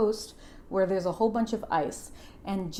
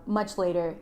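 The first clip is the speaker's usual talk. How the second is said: ordinary delivery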